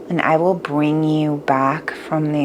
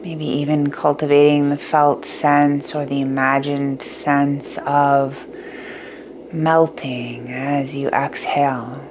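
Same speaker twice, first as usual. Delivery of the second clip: guided meditation; 6 dB quieter than usual. Acted.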